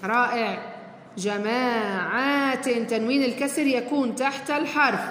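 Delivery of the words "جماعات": slow modeling. Shown as a demonstration. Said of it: "teacher models word slowly".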